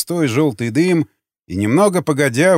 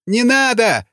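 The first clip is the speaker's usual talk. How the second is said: high pleading wail, lightly acted; sobbing plea; 9 semitones higher, slower than usual. A narrator's reading. Prisoner begs as knife threatens, sobbing plea. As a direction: beg tearfully in a high voice. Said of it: "зарыдал боевик".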